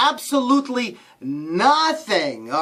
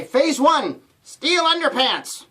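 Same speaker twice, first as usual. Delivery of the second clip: gnome chart voice; 7 semitones higher, slower than usual. Retold or imitated. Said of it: "they say".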